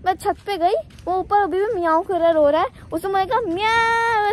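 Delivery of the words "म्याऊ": high, plaintive mock cat meow; mock wailing kitten meow, pitched high; imitated crying meow. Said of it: "ऐसे करते हुए, रोते हुए आया".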